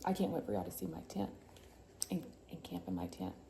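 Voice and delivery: ordinary delivery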